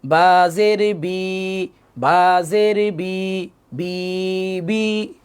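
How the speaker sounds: very slow, every vowel stretched; wrongly drawn out. Shown as a demonstration. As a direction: drag "বাজের বি" out on held vowels. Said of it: "এখন যদি কেউ এভাবে পড়ি".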